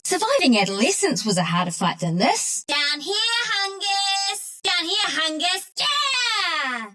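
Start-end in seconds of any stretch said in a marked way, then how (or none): 2.7-5.7 high, drawn-out singsong call. Acted. Calling out to Hungus. 5.8-6.9 high, drawn-out "yeah!"; elated cheer. Acted. Cheering in triumph.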